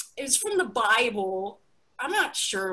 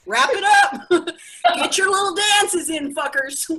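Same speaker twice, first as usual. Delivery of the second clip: loud, higher, laughing order; mock bossy shout; 8 semitones higher, 5 dB louder than usual. TikTok ordering users to finish dancing, loud mock shout. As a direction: bark it like TikTok telling everyone off. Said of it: "TikTok is literally like".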